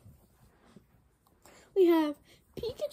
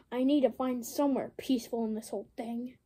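ordinary delivery

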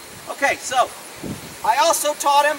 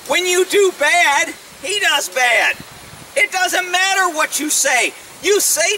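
slow, drawn-out lecturing; slow, emphatic; slower than usual. Real, not acted. Telling viewers what horses care about, slow and emphatic. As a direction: spell it out slowly, word by word. Drives the lesson home.

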